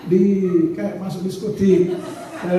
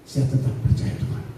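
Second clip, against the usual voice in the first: whispered conviction; 10 semitones lower, 5 dB quieter than usual. Real, not acted. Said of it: "Tuhan mau tolong kah?".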